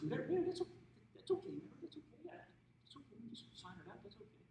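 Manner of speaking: high, flustered retold reassurance; nervous. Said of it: "I'm nervous".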